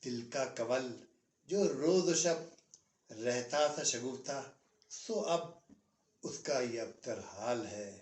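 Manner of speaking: slow, lingering nazm recital, every line weighed; poem recitation. Acted. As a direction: recite the old nazm with a pause after each line. (ठहर-ठहर कर नज़्म पढ़ना)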